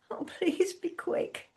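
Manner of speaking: whispered, desperate plea; breathy plea. A narrator's reading. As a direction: barely whisper it.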